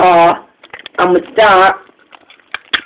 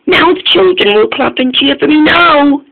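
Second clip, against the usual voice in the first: high and louder, bursting with glee; excited; 8 semitones higher, 3 dB louder than usual. Acted. Dodge arriving at school, expecting cheers, high with excitement. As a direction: sure the children will cheer at last. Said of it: "and he was excited".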